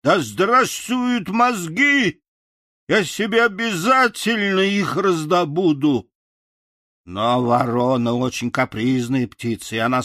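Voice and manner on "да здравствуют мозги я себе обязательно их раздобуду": high joyful cry, slow on the cheer; cheerful shout. Acted. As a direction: shout for joy about brains. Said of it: "весело закричал". (весёлый крик Страшилы)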